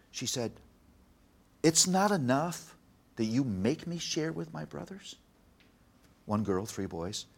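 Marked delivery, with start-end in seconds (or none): 1.6-5.2 higher-pitched imitation of an upset child; raised voice pitch, mock childish complaint; frustrated daughter. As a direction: play a little girl protesting to her parents. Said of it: "I'm frustrated at you and Mom".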